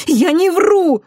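high, loud protest; Кристина crying out. A narrator's reading. Girl protests to the director she isn't lying, crying out. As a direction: shout the denial at a high pitch. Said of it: "воскликнула Кристина".

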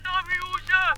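high, far-off trapped spirit calling; tiny ghost voice pleading to be let out; Geisterstimme. A narrator's reading. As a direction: cry out thinly from inside the bottle. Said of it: "Und wieder hört er's".

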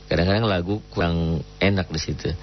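usual speaking voice

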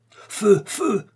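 high, sharp shouted command; urgent cry. A narrator's reading. Doctor ordering his companions to fire at the attacking birds, urgent cry. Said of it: "s'écria le docteur".

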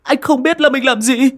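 high, loud panicked cries; frantic despair. Acted. Boy waking to his unconscious girlfriend, frantic crying. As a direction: wail in horror at what you did. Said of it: "như điên như dại".

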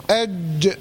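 أج shut tight on ج, then a short bounce; أج with crisp echoing release on ج; stopped jeem with qalqalah. Shown as a demonstration. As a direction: block the sound at ج in أج, then pop it free. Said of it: "يتحبس. هقول إيه؟".

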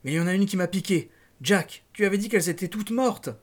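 a touch of hurt accusation; mildly distraught. A narrator's reading. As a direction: plead in rising distress, short of tears. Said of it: "Elle éclata en sanglots".

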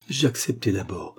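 plain reading voice

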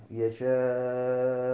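يشاء with a sustained final vowel; lengthened madd. Shown as a demonstration. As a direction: hold the long aa of يشاء level. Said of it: "وإذا وقف على نحو".

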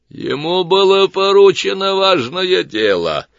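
loud, high, detached pronouncement; impassive judge voice. A narrator's reading. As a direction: announce it like an unfeeling judge. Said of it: "первый бесстрастный голос". (бесстрастный голос судьи)

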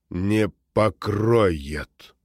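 slow, grim, low demon voice. Acted. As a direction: drag each word out like a dark demon. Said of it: "Мрачный и низкий голос демона".

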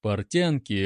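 ordinary delivery

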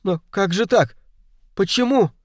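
high, startled question. Acted. Puzzled listener demands an answer from Poirot, startled. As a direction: ask in baffled surprise, pitch up.

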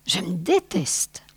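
soft unvoiced whisper; mortified wife whispers to her husband; whispered self-loathing. A narrator's reading. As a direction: breathe it out ashamed.

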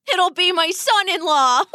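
mock villain delight; gloating laugh. Father gloats, then laughs.

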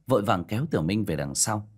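ordinary delivery

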